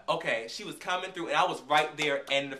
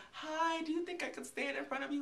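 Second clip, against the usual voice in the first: sweet polite ask; 11 semitones higher, 6 dB quieter than usual. Retold or imitated. Asking tall man to move.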